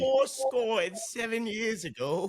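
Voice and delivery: high, slow, grand speech voice; mock orator. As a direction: declaim it slowly with mock pomp. Mock oratory quoting a famous speech.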